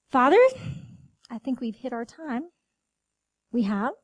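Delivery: hushed thanksgiving prayer. Real, not acted. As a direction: pray it gently, with long pauses. Closing prayer.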